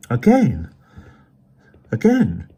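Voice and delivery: high, loud astonished repeat; stunned disbelief. A narrator's reading. Emerson astonished.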